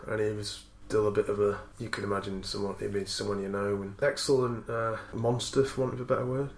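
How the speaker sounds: ordinary delivery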